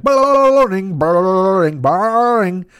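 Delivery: alien phone mimic. Aliens copy the phone to each other, high rattling trill. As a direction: mimic the ring with a wobbling jaw. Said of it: "she loved them going like".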